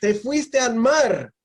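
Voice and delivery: high, loud, drawn-out checking back; realization. Retold or imitated. Listener checks the islander meant the sea, loud realization. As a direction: spell it out slowly, high and loud.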